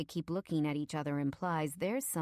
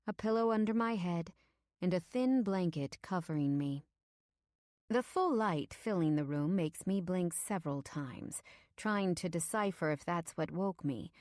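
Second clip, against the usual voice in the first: usual speaking voice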